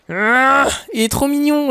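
high, drawn-out cooing over something cute. Real, not acted. Player gushing over a little creature, cooing. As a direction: coo in a high, lingering voice.

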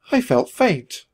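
usual speaking voice

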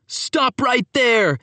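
high, slow commanding shout. Acted. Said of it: "he shouted".

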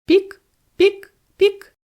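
very high, loud peeping; hungry chick cry. A narrator's reading. Hungry chick crying to its mother, high peeps. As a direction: peep for food like a hungry chick. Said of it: "кричав".

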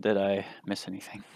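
normal talking voice

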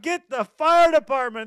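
shouted high and loud; panicked shout. Retold or imitated. Stranded on a log jam yelling to friends, panicked shout. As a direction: yell for help across the river.

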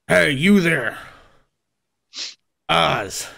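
swaggering tough calling out a debtor; demanding tough-guy character voice; gruff debt collector, demanding. Acted. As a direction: hail him like a loan shark. Game master plays Maloon.

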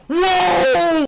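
high, loud yelp of alarm; jump-scare cry; startled yell. Real, not acted. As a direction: yell out in sudden shock. Startled while running from clown.

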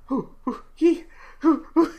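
high, hammed-up hoo-hee breaths; mock Lamaze breathing. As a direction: pant like a woman in labor. Lamaze breathing joke after midwife.

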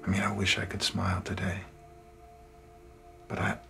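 soft, low, downcast admission; subdued confession. Acted. Man admitting he missed his promotion, dejected. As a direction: confess the disappointment quietly, voice sunk. Confessing failure, cannot smile today.

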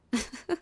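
brief high laugh; mocking chuckle. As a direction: chuckle at your own jibe. Vampire mocking the hunter's speech.

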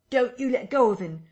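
high, loud urgent warning; crying out. Acted. Big navvy cries to the doctor, high and loud. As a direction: demand they keep hold. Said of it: "cried the big navvy".

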